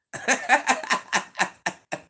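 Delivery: high laughing burst; cracking up about the beef rib. Real, not acted. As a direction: laugh high at your own joke.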